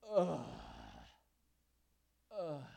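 exasperated groan; Jesus sighing at the sign-seekers; deep groan. Retold or imitated. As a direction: groan like a sigh from the spirit. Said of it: "He sighed deeply".

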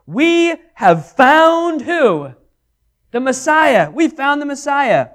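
high, loud and slow, bursting with news; excited announcement. Acted. Andrew telling his brother Simon, excited and loud. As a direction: cry out the discovery with joy. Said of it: "saith unto him".

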